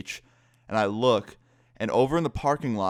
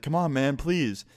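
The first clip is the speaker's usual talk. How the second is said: imitating a pleading buddy, higher pitch; higher-pitched mock begging; 5 semitones higher, slower than usual. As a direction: lightly wheedle like a nagging friend. Friend begs him to go over.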